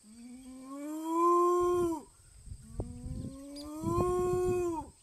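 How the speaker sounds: long, loud swelling moo; cow moo. Acted. Cow asks the librarian for something, mooing loudly. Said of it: "the cow went".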